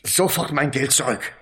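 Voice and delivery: furious man demanding his money back; angry demand. Acted. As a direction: bark the demand at him.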